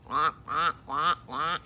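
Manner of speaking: mock duck sounds. Shown as a demonstration. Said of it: "Can you make a duck bill?".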